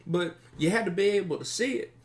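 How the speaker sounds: high, hurried, stammering voice; nervous captive bandit. Acted. Captive bandit recalls what his friend said, high and flustered. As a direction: blurt it out with false starts.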